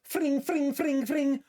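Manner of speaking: high, ringing repeated "fring"; imitated throwing-star sounds. Streamer re-enacting launching ninja stars, playful sound effect. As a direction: mimic launching stars with high-pitched zings. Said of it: "I remember going like this".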